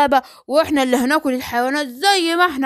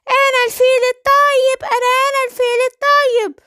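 higher, put-on gentle elephant voice; good elephant; 8 semitones higher than usual. The elephant tells the lion he is the good elephant, in a higher put-on voice. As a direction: play a harmless, kindly elephant.

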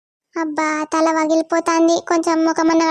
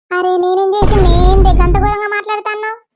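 louder, indignant protest at mom; defensive yell; 3 semitones higher, 6 dB louder than usual. Acted. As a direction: shout back, offended and hurt. Daughter yells back at mother. (అమ్మపై కోపంగా గట్టిగా అరుస్తూ)

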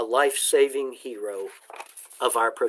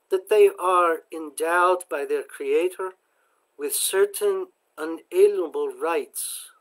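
put-on slow, higher, lightly accented recital; Zofia's sweet accent; 4 semitones higher than usual. A narrator's reading. Zofia reciting the Declaration from memory, lightly voiced sweet accent. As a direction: recite it slowly like an immigrant girl proud of the words. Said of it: "she quoted in her sweet accent".

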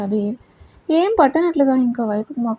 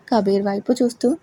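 ordinary delivery, nothing marked

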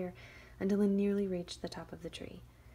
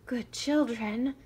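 slow, loud, higher pleading; begging wolf; 5 semitones higher, 10 dB louder, slower than usual. Acted. Wolf begging the girls. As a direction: plead sweetly. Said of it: "the wolf begged".

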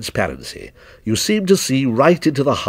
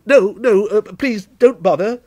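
high, hurried protest; higher-pitched, stammering refusal; flustered cry; 8 semitones higher than usual. A narrator's reading. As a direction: cut them off in embarrassment. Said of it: "cried Timothy".

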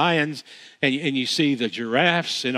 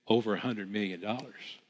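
much softer and lower, humbly understated; very low, humble; 6 semitones lower, 11 dB quieter than usual. Retold or imitated. Donor answering the interviewer's question, very low and humble. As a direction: let the huge figure slip out low and modest. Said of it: "very low, very humble".

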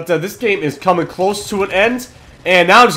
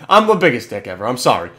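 ordinary delivery

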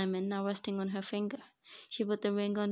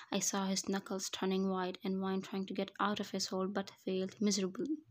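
plain reading voice

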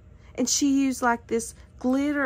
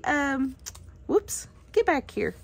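usual speaking voice

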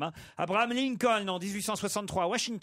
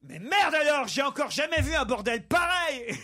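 furious headmaster; 8 semitones higher than usual. Acted. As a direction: burst in fuming at the chaos. Headmaster bursts in angrily.

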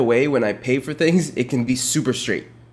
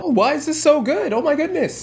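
high, exaggerated mock delight; mocking imitation; 12 semitones higher than usual. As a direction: parody his gushing over dessert. Mocking his reaction.

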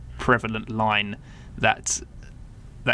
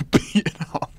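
laughing; 7 semitones higher than usual. Real, not acted. Laughing at the phrase.

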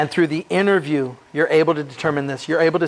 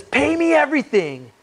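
high, sharp mock demand; harsh demand; 9 semitones higher than usual. Servant throttling his debtor, hammed-up high demand. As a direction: bark it like a bully collecting debt.

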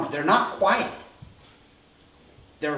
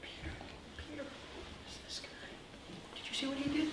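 hushed, very soft, high murmur; stunned, secretive hush; awed whispering; 9 semitones higher, 15 dB quieter than usual. Acted. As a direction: whisper in amazement behind his back. Awed disciples murmur among themselves.

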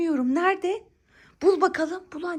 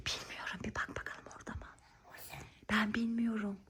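breathy secret whisper; whispering while hiding; 7 semitones lower, 16 dB quieter than usual. Real, not acted. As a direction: whisper it conspiratorially. Pretending not to know, whispering.

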